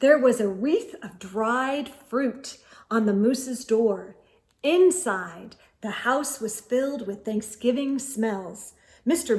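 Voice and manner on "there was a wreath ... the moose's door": usual speaking voice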